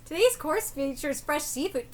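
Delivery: usual speaking voice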